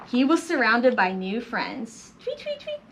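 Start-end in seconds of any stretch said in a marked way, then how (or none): 2.2-2.8 very high, soft triple chirp; light piping bird calls; birds tweeting. Acted. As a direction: imitate small birds in quick squeaks. New bird friends tweeting.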